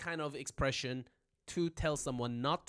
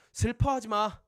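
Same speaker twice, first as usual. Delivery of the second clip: acted comfort; 5 semitones higher, 7 dB louder, much faster than usual. Comforting someone with chocolate.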